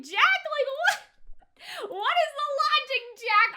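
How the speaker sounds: high, loud, disbelieving cry at Jack; incredulous outburst. Retold or imitated. Reacting to Jack's call, loud incredulous squeal. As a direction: throw your hands up at Jack's nonsense. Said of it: "and I'm just like".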